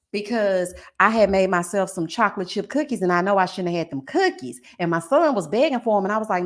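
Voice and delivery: normal talking voice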